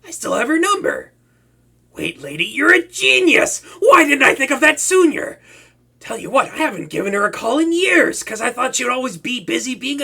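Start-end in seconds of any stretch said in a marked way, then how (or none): 1.9-5.4 overjoyed at a simple idea; excited. Acted. Villain praising his hostage's idea, loud excited delight. Hostage suggests calling the hero.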